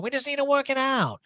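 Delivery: high sing-song Erica impression; high, breathy-sweet mocking of a reality star. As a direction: coo it in a sugary mocking voice.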